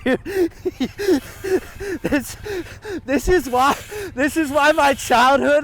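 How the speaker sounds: laughing through the words; loud laugh spilling into speech. Real, not acted. Laughing loudly while talking.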